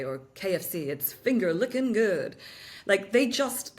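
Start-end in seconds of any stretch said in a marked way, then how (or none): 0.9-2.4 slow mock-American slogan; drawn-out American catchphrase; American accent. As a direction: stretch it out like an American ad. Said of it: "they just have an American accent to them".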